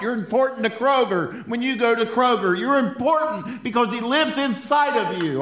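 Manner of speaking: fired-up preacher, high and loud; full-force preaching. Real, not acted.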